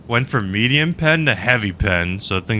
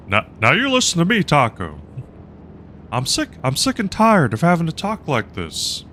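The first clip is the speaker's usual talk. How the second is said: rough Hank Hill take, pitched higher and rushed; 7 semitones higher than usual. Acted. Streamer doing Hank Hill to scold chat user Taco, higher and fast. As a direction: scold Taco as a put-upon Texan propane man. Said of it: "redeemed Talk Like Hank Hill".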